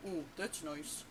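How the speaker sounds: tiny puckered-lip frog voice; meek, pinched joke punchline; small-mouthed frog. Acted. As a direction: say it through a pursed little mouth, rounding the vowels. Frog hides his big mouth from wolf.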